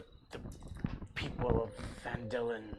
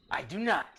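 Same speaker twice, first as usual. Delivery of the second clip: ordinary talk, nothing marked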